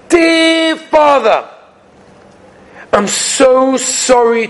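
very slow, drawn-out, heartfelt plea; apologetic son begging, lingering on each phrase; gentle pleading reading. Acted. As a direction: stretch the key words imploringly. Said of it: "read in a totally different manner".

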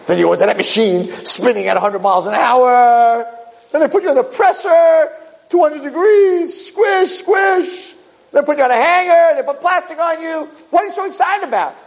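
very high falsetto, partly shouted; a tiny voice crying out the ordeal; squeaky suit voice. Acted. The suit describing the spin and the presser, in a squeaky shout.

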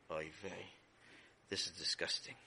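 low, soft weary disgust; defeated groan. Acted. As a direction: mutter it, beaten.

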